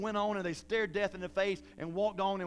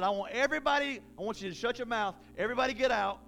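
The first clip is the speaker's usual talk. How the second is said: loud, raised, forceful dismissal; commanding order; 4 semitones higher, 4 dB louder, faster than usual. Acted. Acting Jesus ordering everybody out of the house, loud command. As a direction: clear the room with authority. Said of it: "he told them to get out".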